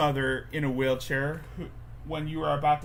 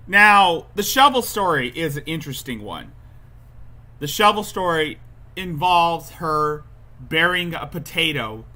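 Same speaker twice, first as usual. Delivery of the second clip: loud announcing; 3 semitones higher, 8 dB louder than usual. Real, not acted. Announcing the shovel story.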